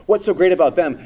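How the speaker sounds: the envious question pushed out loud. Shown as a demonstration. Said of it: "Not only asks".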